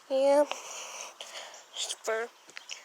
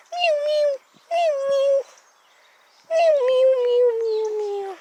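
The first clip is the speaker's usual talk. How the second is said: high, loud cat meows; meowing like a cat; 10 semitones higher, 6 dB louder than usual. Pretend. The Cat Whisperer meowing to her kitty, high and loud.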